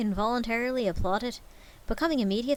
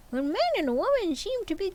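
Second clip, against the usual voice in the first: put-on high, earnest young nephew; lightly voiced eager nephew, raised pitch; 9 semitones higher than usual. Nephew pleading to his uncle, high earnest voice.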